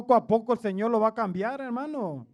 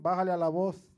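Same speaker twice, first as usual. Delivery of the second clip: softer, mock-hushed wife's message; imitating wife, hushed; 5 semitones lower, 6 dB quieter than usual. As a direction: playfully quote the wife under your breath. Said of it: "mi esposa a veces". (imitando a la esposa en voz baja)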